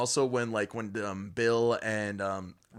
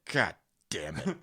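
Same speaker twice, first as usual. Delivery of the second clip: exasperated Pennywise; 4 semitones lower, slower than usual. Retold or imitated. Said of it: "he's just like".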